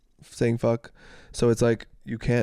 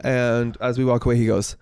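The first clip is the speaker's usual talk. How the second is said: ordinary delivery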